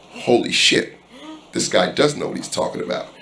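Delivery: soft stunned under-breath realization; hushed disbelief. Retold or imitated. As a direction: breathe it out in shock.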